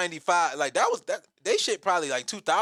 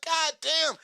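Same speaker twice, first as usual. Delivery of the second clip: shocked; 11 semitones higher than usual. Retold or imitated. Shocked at new fee.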